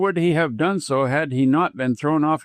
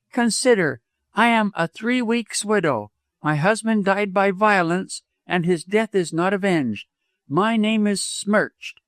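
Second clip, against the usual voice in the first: earnest, higher-pitched woman; remonstrating widow; 7 semitones higher than usual. A narrator's reading. Three weeks' widow puts off doctor, remonstrating. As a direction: plead propriety in a raised pitch.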